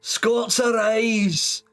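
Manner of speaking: high, drawn-out mock Scottish battle cry; rally the clans in a hammy Scottish accent; bad Scottish accent. Streamer rousing the Scots for fun, hammed-up Scottish accent. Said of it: "I do a very bad Scottish accent".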